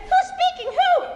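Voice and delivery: very high puzzled voice, laughing on the last; high and fluttery on the phone. Acted.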